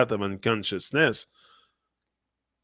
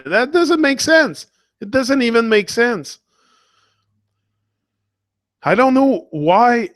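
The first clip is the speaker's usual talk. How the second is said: exasperated outburst; 11 semitones higher, 3 dB louder than usual. Real, not acted. Outraged rant about killing animals.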